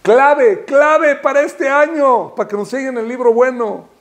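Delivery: high, emphatic proclamation; impassioned preaching. Real, not acted. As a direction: announce the key with fervor. Preaching at full force.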